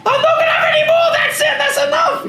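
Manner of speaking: very high shouted outburst, hammed-up; mock shriek of a worn-out mother; exasperated shout. New mother refusing more children.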